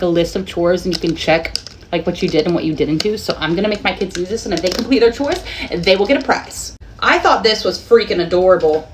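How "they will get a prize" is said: ordinary talk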